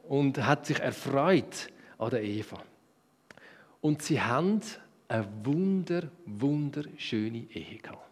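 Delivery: normal talking voice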